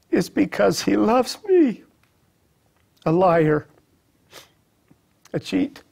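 impassioned plea, pitched high with long pauses; emotional confession. Retold or imitated. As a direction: confess each fault with rising emotion. Emotional retelling of his confession.

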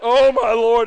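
loud mock gasp of disapproval; hammed-up public dismay; shocked onlooker. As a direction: imitate a scandalised bystander, loud and drawn out.

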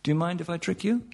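usual speaking voice

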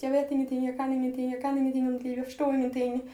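mock panic, retelling a girl's breakdown in the snow; retold panicked cry. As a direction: echo her panic without full screaming. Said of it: "hon skrek till mig i panikångest".

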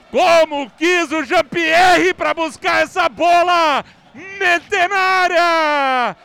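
explosive goal commentary. Real, not acted. Goal replay, commentator still shouting.

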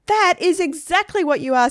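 high, loud jeering retort; insistent taunt. A narrator's reading. Stephen contradicts Margaret about being a poet, taunting. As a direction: insist loudly, needling her.

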